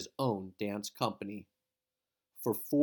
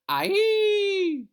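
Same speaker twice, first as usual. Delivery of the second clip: a high, loud, ringing "Aye!" as the dancer leaps; leaping joyful yelp; excited cry; 8 dB louder than usual. Acted. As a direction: cry out in sudden delight. Dancer leaps, narrator cries out.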